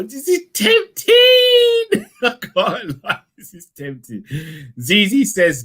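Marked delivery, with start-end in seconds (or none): 0.0-2.1 tempting stretched high and slow; high, slow tempted; drawn-out tempted squeal. Real, not acted. 2.1-4.2 laughing. Real, not acted.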